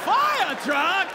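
high, loud thrilled question; delighted to hear of a fire truck; excited passenger asking about the fire truck. Acted. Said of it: "He is excited".

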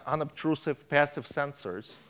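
ordinary delivery

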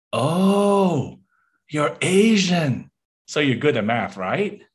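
high, drawn-out mock discovery; exaggerated realisation. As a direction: stretch it out as if it dawns on you. Acts out a stereotyping stranger.

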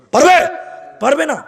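high, loud rhetorical challenge; full-force sermon shout; fiery preaching. Real, not acted. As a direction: fling the question at the crowd, then answer it. Rhetorical question to crowd.

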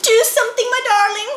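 high, loud desperate plea; pleading cry. A narrator's reading. Missus Moose begging her husband for help, pleading high. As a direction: beg him to act. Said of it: "said Missus Moose".

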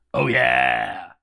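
booming mascot voice reading the post; Kool-Aid Man voice. Retold or imitated. Narrator reads the fan post as Kool-Aid Man. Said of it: "Evil Kool-Aid Man Delarodom".

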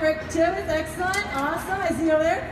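normal talking voice

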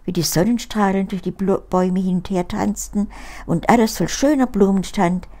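ordinary delivery

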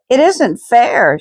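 high, slow outburst; a girl bursting out in protest; crying out. A narrator's reading. Girl bursts out at bedtime Bible story, high protest. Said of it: "she cried".

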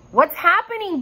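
high alarmed cry; alarmed yell. A narrator's reading. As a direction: call out in alarm. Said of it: "Bo yelled".

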